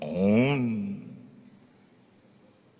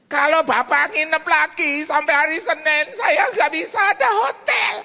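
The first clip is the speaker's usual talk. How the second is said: put-on high, loud, slow old lady; wailing widow voice; old woman caller; 6 dB louder, slower than usual. Widow fretting to the pastor, high and loud. Widow worries about lodging.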